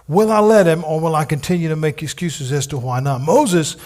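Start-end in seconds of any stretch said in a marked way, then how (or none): 0.1-0.8 repeated higher and louder. Shown as a demonstration. Preacher challenging the congregation, louder repeat. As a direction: push the question harder the second time.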